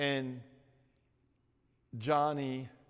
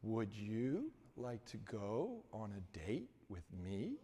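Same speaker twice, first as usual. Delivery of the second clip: clearest voice; 2 semitones lower, 6 dB quieter than usual. Retold or imitated. Johnny asks the campus beauty out without a stammer. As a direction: ask it cleanly, every word even. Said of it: "with the most clearest voice".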